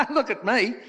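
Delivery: high, loud incredulous punchline; high-pitched mock-defensive wife; Australian wife. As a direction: protest as a joke Aussie widow. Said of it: "The Australian's wife said".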